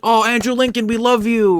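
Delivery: high-pitched adoring fan squeal; loud, high mock adoration; mock gushing. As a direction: gush like a starstruck fan, hammed-up. Mock fans gushing over an actor.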